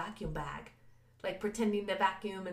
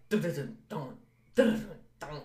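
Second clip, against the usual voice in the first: imitating heartbeat; 4 semitones lower than usual. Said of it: "my heart is doing this thing".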